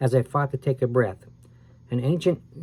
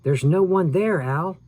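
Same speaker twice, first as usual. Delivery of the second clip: higher, louder frantic shout; Sam shouted; 6 semitones higher, 3 dB louder than usual. A narrator's reading. Sam shouts to Al that nobody is there: frantic higher shout. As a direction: shout at your scared friend. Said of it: "Sam shouted".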